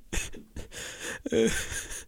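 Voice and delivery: high, quiet broken sobs; acted sobbing. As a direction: sob softly, out of breath. Lone survivor sobbing in trench.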